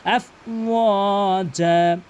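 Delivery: আফওয়াজা with long held "waaa"; slow chanted আফওয়াজা; one-alif stretch. Shown as a demonstration. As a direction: draw out both long vowels of আফওয়াজা. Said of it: "এক আলিফ টেনে পড়তে হবে".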